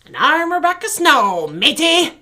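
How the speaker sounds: lightly voiced pirate; hint of a pirate. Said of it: "Talk Like a Pirate Day".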